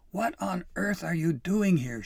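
high, loud outraged demand; loud, scandalized scolding; yelling. A narrator's reading. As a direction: yell in shocked indignation. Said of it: "she yelled".

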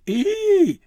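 very high, loud squealed shriek; piercing squeal of shock; startled shriek. A narrator's reading. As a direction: shriek at full pitch. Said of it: "Dabbledy shrieked".

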